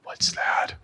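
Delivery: hushed, breathy question; whispered. Retold or imitated. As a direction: whisper it like a shocked mom. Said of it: "my mom comes over, like".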